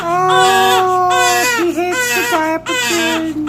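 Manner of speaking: very high, loud soothing coo. Real, not acted. Mom soothes crying baby.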